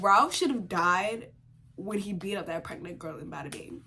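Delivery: usual speaking voice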